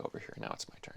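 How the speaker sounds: breathy unvoiced whisper; jokingly whispers that it's his turn now; whispered aside. Real, not acted. Reclaiming the floor, dropping voice.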